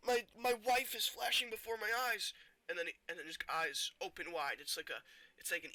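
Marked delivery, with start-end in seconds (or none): 0.1-2.3 high, loud, stammered mock distress; hammed-up tortured hero; acted agony. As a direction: cry out through pretend pain.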